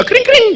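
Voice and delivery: high ringing cring cring; bike bell imitation. Comic imitating a passing bicycle bell.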